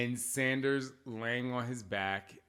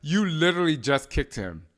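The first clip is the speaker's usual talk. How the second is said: raised, higher retold parental objection; exasperated parent retort; 5 semitones higher, 6 dB louder than usual. Mom retells answering her tattling son, exasperated and louder. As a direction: call out the kid in disbelief.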